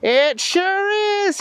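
very high, drawn-out cartoon voice; Booger McFarland. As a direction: answer slowly in a high, goofy voice. Booger answers the caller.

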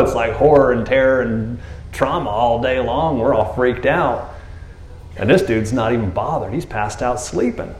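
usual speaking voice

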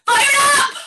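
high-pitched, pumped-up cry; rallying shout. Shown as a demonstration. Instructor cues the class to yell their word, rallying shout. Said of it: "I'll say".

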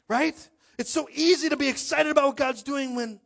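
high, loud, shouting preacher; preaching at full force. Real, not acted. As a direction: drive it home at the top of the voice.

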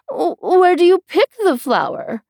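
fussy queen. Acted.

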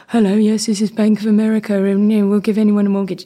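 hurried, stiff little commercial voice; shy editor's ad read. Retold or imitated. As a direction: rush through a nervous bank advert. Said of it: "a very sort of shy editor to be like".

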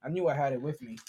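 ordinary delivery

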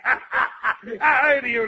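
normal talking voice

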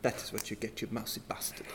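whispered insult; muttering. Acted. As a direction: hiss it under your breath. Host muttering at puppet.

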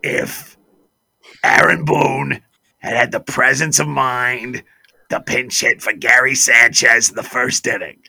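a hint of a pompous fan lecturing; caller voice. Radio caller second-guessing the Yankees manager, mildly mocked. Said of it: "he's talking like this".

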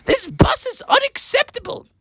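high disgusted mother voice. Acted. Mother Bear complains about the fungus, high and disgusted. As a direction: squeal it up high, grossed out. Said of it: "And Mother was like".

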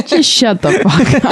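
slow, laughing through the words; half laughing, slowed down. Real, not acted. Laughing at crude joke.

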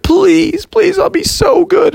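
put-on very high begging kid; desperate falsetto pleading; Froggy. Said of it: "he kept begging and begging".

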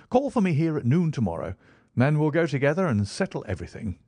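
lightly voiced Holmes.

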